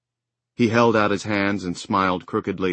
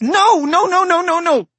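put-on high, loud childish screams of refusal; child screaming; 10 dB louder than usual. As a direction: shriek no over and over like a child. Child refusing the first aid.

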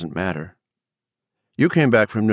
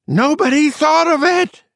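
very high, loud shriek; sudden scream; 5 dB louder than usual. A narrator's reading. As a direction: burst out screaming at the top of your range. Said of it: "she screamed suddenly".

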